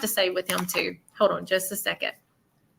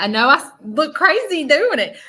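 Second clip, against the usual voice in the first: ordinary talk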